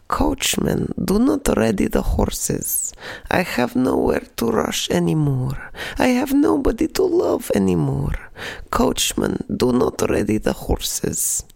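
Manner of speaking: put-on high, slow English in a thick Russian accent; a grandmother translating a song in her thick Russian accent. Granny translating the chorus for her grandchild, thick Russian accent. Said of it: "In her thick Russian accent".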